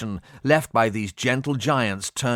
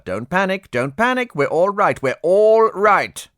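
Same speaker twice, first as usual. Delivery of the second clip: panicky self-reassurance; 8 semitones higher, 6 dB louder than usual. Acted. Pilot steadying himself as ship nears ground. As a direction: talk himself down while panicking. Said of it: "fighting a war on two fronts".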